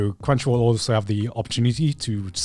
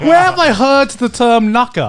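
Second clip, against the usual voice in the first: puzzled high voice; 11 semitones higher, 6 dB louder than usual. Retold or imitated. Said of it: "and I was like".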